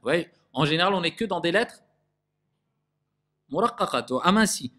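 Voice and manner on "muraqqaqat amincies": usual speaking voice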